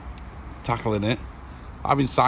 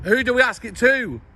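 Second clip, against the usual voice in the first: raised voice, calling across distance; shouting over machinery; 11 semitones higher than usual. Real, not acted. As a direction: shout so he can hear you. Shouted exchange across camp fence.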